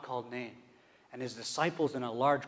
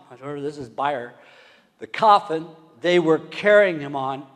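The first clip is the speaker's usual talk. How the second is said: ordinary talk, nothing marked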